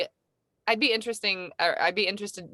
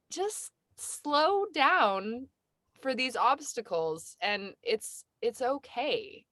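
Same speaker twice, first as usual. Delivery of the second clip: slow gentle; 3 semitones higher, 4 dB quieter, slower than usual. Real, not acted. Telling herself to slow.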